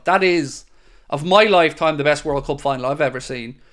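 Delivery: ordinary talk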